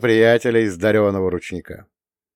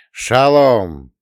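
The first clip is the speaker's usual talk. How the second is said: loud cheerful hail; shouted greeting; 6 dB louder than usual. A narrator's reading. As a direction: call the greeting out. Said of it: "крикнула дуэтом".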